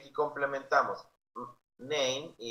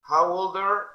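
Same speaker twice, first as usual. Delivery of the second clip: put-on How old are loud and higher; loud model; 7 semitones higher, 9 dB louder than usual. Shown as a demonstration. Teacher drilling class, loud and high.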